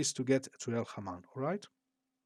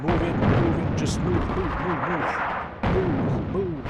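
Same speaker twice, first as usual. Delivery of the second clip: impatient commands; 4 semitones higher, 6 dB louder than usual. Pretend. Ordering units forward.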